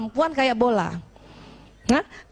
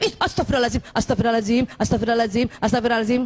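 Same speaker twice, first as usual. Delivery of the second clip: muttered prayer; 5 semitones lower, much faster than usual. Retold or imitated. Wife repeats prayer for repentance.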